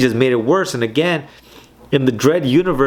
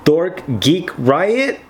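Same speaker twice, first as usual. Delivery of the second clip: mocking disbelief; 4 semitones higher, much slower than usual. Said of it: "He's like".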